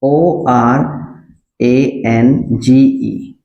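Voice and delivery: each letter slow and separate; teacher spelling a word slowly for students. Shown as a demonstration. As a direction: read the letters out one at a time, slowly. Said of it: "जैसे मैंने यहाँ पे लिखा".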